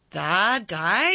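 loud, drily triumphant Yorkshire question; dry exultation. A narrator's reading. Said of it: "with a dry exultation".

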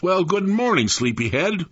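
high, lilting mother's hello; motherly greeting. A narrator's reading. Mother greeting her sleepy son at breakfast, lilting motherly voice. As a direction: greet a sleepy child with lifted pitch. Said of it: "she greeted me".

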